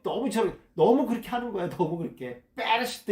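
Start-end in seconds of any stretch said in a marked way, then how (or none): 2.5-3.1 베레시트 with a tense, forceful opening B, like 뻬; 베레시트 begun on a doubled, unaspirated 뻬; hard first consonant. Shown as a demonstration. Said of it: "이렇게 읽어야 됩니다".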